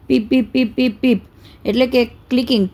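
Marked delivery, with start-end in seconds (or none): 0.1-1.2 quick run of short, even beeps; imitated beeps. Shown as a demonstration. Teacher demonstrates the robot's caution signal, imitated beeping. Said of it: "of caution signals".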